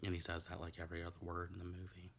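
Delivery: normal talking voice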